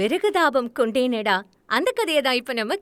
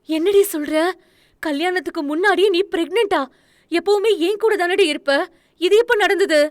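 much higher, louder shocked questions; 7 semitones higher, 4 dB louder, faster than usual. A narrator's reading. Friend stunned by the pregnancy news, shocked and loud. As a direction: gasp it out in disbelief. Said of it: "அதிர்ச்சியில் அவள் கேட்டு".

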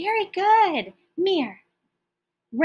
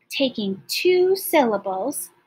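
ordinary delivery

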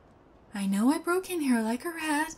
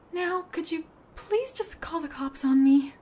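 ordinary talk

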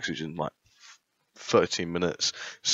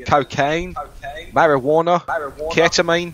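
put-on Australian accent. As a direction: play an Aussie official in an Australian accent.

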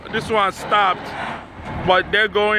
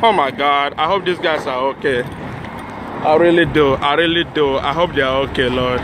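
shaken and low; 7 semitones lower than usual. Real, not acted. Watching trucks crash into fire.

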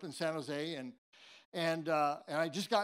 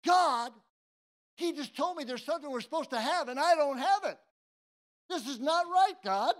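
raised, pleading protest; frustrated complaint to God; 7 semitones higher, 6 dB louder than usual. Retold or imitated. Worker complaining to God he missed out, loud and frustrated. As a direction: complain to God, upset and insistent.

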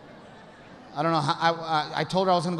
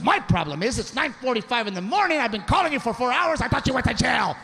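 mock scolding; 6 semitones higher than usual. Wife scolding husband.

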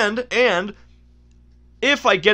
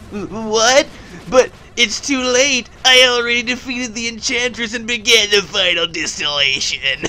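lightly hammed-up cartoon despair; game character voice; 2 semitones higher than usual. Plague Knight realising it is too late, melodramatic dismay. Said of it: "now I'm gonna do the voices".

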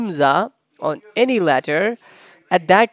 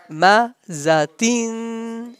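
Mazatin slow, with a long held tin; slow demonstration; 4 semitones higher, slower than usual. Shown as a demonstration. Teacher modelling the reading for students, slow demonstration. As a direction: draw out the end of Mazatin. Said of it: "Like this".

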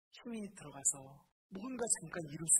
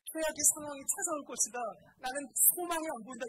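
mock despair; 7 semitones higher, 6 dB louder than usual. Voicing despairing negative thoughts.

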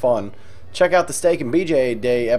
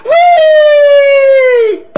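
much higher, much louder drawn-out squeal; wild whoop; 12 dB louder than usual. Acted. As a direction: crash in whooping with glee. Seamus bursts in.

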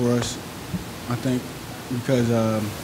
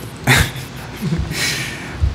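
short laugh; 6 semitones higher than usual. Real, not acted. Player laughs after coach's remark.

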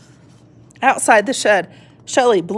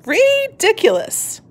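much higher, slow sneer; scoffing cat; 12 semitones higher, slower than usual. Acted. The cat looks down and mocks, high slow scoff. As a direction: draw it out with disdain.

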